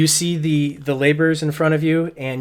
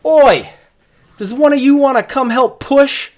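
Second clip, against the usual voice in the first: much higher, loud shout across the crowd; laborer yelling; 12 semitones higher, 11 dB louder than usual. Acted. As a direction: bellow for a helper to come push. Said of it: "laborers yell back".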